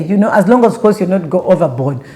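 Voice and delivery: ordinary talk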